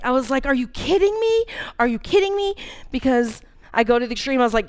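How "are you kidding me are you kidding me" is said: higher, exasperated disbelief; mock outrage. Woman raging at God the night before the scan, incredulous. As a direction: throw a fit at God, incredulous. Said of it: "I was throwing a fit".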